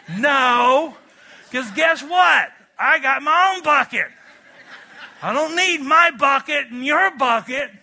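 loud, high, laughing shout back; real laughing yell. Real, not acted. Preacher shouts rebuttal laughing.

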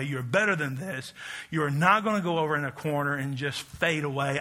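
ordinary talk

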